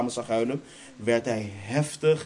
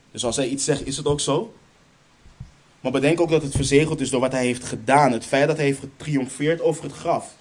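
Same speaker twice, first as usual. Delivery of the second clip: louder, driving preacher's build; fervent preaching; 2 semitones higher, 4 dB louder than usual. Acted. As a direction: hammer home the promise. Building emphatic sermon passage. (vurig preken, luider en dwingend)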